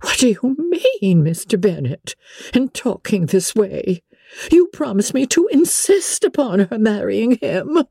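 high, indignant matron's reproach; excessively disappointed. A narrator's reading. Wife reproaching her husband for breaking his promise, bitterly disappointed. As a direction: protest in a high, let-down voice. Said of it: "was excessively disappointed".

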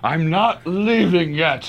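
high, forceful patriarch proclamation, slow at first; Brian Cox impression. Radio host plays the Succession patriarch refusing to step down, defiant impression. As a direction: declaim like a defiant boss clinging to power. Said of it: "You think that was a good Brian Cox?".

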